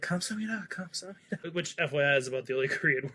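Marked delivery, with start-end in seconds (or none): 0.0-1.5 soft, high-pitched repeated thanks; retold grateful murmur. Visitors thank the helpful Korean man, retold soft thanks. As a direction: say thanks lightly and high, twice over. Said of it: "And we're just like".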